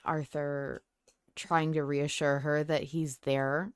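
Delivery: ordinary delivery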